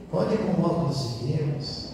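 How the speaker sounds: soft, subdued appeal; gentle exhortation. Real, not acted. Priest repeating his advice to families, gently. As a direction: urge them quietly, without force.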